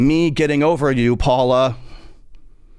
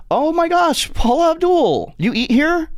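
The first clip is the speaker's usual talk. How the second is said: starstruck shriek. Pretend. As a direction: shriek at spotting your idol. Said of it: "and I'm like".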